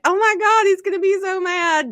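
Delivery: high, loud imitated alarm; mock panic. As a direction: squeal it like you're dreading his reaction. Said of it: "and I'm like".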